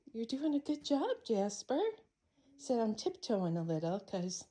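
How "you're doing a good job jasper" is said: pet praise. Real, not acted. Praising cat.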